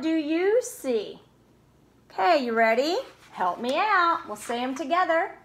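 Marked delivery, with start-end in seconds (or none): none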